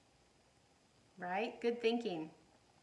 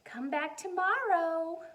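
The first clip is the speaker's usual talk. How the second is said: louder, higher friendly call; 7 semitones higher, 8 dB louder than usual. Acted. Teacher calling goodbye to students at the door. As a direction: call it after the leaving children. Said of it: "called Missus Peachum".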